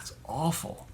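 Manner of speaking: breathless whisper of disgust; whispered dismay. Real, not acted. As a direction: drop to a whisper on "it's awful". Dismay at the shop story.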